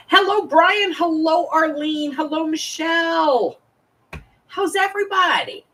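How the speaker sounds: high, bright sing-out to viewers; cheery greeting. Real, not acted.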